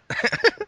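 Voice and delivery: squeaky high-pitched giggle. Real, not acted. Giggle at a cheeky suggestion.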